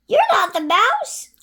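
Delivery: high, loud, disappointed; child voice. Acted. Gruffalo's child telling the snake, high and loud. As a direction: call it out like a letdown kid.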